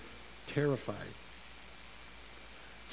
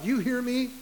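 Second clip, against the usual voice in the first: a touch of a stern parent, higher; lightly mock-scolding a child; 9 semitones higher than usual. Parent warning a child, mock stern. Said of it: "When you say to a child".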